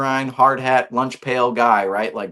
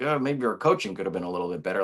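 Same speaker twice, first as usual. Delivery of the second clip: passive-aggressive aside; 6 semitones lower, 7 dB quieter, faster than usual. Retold or imitated. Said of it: "passive-aggressive comments like".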